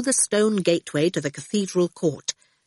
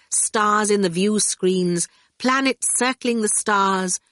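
ordinary delivery, nothing marked

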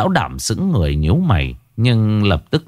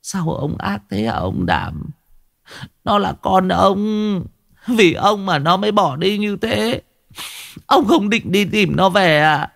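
tearful reproach; 10 semitones higher than usual. A narrator's reading. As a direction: accuse the husband through sobs. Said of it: "Bà Mẫn thì khóc tu tu". (vừa khóc vừa trách móc, giọng cao)

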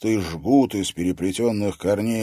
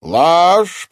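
one high, booming shout; furious villain's outburst; thundering accusation; 11 semitones higher, 12 dB louder than usual. A narrator's reading. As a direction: roar it so it echoes under the vault. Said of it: "прогремела под сводом".

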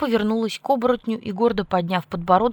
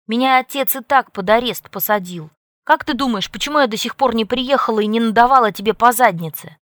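angry; 4 semitones higher, 8 dB louder than usual. A narrator's reading. Angry scolding of Jake.

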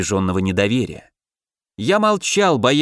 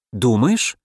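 a barely caught flutter of nerves; faint unease. A narrator's reading. Woman questioning the man's reassurance, faintly anxious. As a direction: ask it with slight, held-back unease. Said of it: "В её голосе послышалось еле уловимое волнение".

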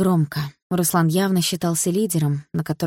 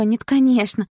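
high, urgent denial; worried girlfriend's protest; anxious denial; 6 semitones higher, 4 dB louder than usual. Acted. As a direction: deny it hurriedly in a high voice. Girlfriend anxiously denies wanting breakup.

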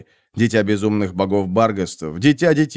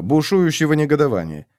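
ordinary delivery

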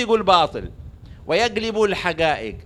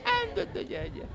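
very high, hushed squeak; comic punchline voice; 10 dB quieter than usual. Acted. Teller gives the chicken punchline, high hushed voice. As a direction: deliver it tiny, high and soft.

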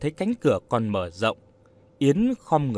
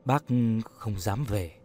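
low, quiet reply; speaking softly; 4 semitones lower, 6 dB quieter than usual. A narrator's reading. Old man admitting to Yến he dare not return, speaking softly. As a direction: lower the voice and say it small. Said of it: "nói nhỏ".

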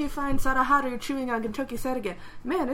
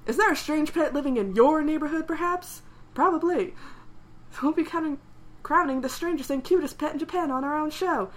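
TV ad read; 5 semitones higher than usual. Retold or imitated. Reading TV ad copy.

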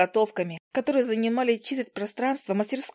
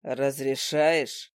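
lower, very slow, smug smirk; smirking question; 6 semitones lower, much slower than usual. A narrator's reading. Damian teasing Izi with a smug smirk. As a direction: ask it with a sly grin. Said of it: "ухмыльнувшись".

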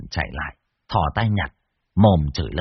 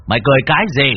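louder, forceful demand; stern demand; 3 semitones higher, 6 dB louder, much faster than usual. A narrator's reading. Cậu repeats the challenge to the possessed man, louder stern demand. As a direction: raise the voice and punch the question out. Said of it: "Cậu Tẩy nghiêm mặt".

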